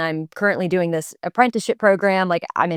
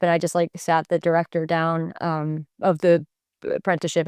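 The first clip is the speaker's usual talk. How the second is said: ordinary talk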